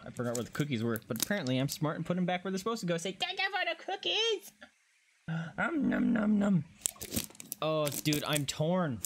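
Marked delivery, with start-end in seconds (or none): 3.1-4.5 very high squeaky thanks; cutesy put-on voice. Player playfully giving thanks for snacks, cutesy squeak. 5.6-6.6 high, cartoonish nom-noms; mock gobbling, pitched up; playful munching noises. Player pretending to gobble cookies, playful munching noises.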